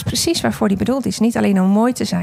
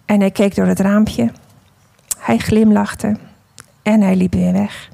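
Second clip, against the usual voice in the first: unhurried storytelling voice, read as the clay vase; story narration. A narrator's reading. Preacher telling the congregation a parable, in storytelling voice.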